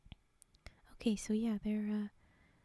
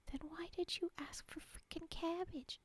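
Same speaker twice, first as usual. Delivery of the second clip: mock exasperated; 6 semitones higher, 7 dB quieter than usual. Mocking the pig's logic.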